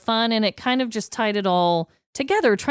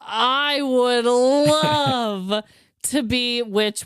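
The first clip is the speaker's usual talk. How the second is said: lightly croaked witch, drawn out slow; a hint of a cackling witch, savoring each word; 3 semitones higher, much slower than usual. Host to co-host, trying out a mild witch voice. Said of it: "You do love your witch voice".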